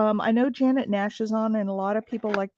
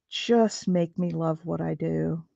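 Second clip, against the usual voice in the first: ordinary talk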